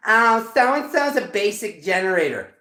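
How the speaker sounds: high, loud, drawn-out mimicry; mocking imitation. Reader mimicking label-obsessed people, high mocking voice.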